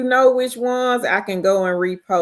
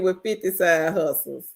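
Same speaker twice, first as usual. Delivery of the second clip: ordinary delivery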